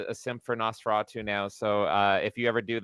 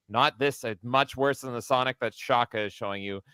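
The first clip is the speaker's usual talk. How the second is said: usual speaking voice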